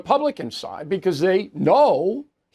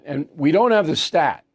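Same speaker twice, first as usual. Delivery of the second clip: ordinary talk, nothing marked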